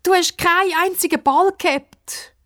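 high-pitched wailing reproach; desperate, high character voice; despairing. A narrator's reading. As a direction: call out in dismay. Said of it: "ruft Pippa verzweifelt".

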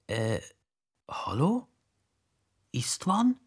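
soft, hesitant greeting with rising questions; timid, careful call; cautious. A narrator's reading. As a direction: tentatively check whether someone is there. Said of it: "Sagt der Dure vorsichtig".

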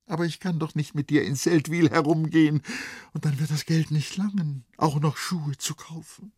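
hohe, helle Mädchenstimme, sehnsüchtig und fröhlich; junges Mädchen. Acted. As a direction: sprich als verliebtes Mädchen, das tanzen will. Vrenchen spricht, Erzähler liest. (höhere Mädchenstimme, verträumt)

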